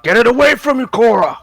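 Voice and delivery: high, loud warning shout; urgent shout. Acted. Player warning Cora to get it away, urgent shout. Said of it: "And I'll shout".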